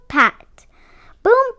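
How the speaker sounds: ordinary delivery, nothing marked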